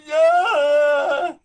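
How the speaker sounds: loud, high rising cheer; a burst of real triumph; excited. Real, not acted. Student cheers as the bow stays up, loud and high.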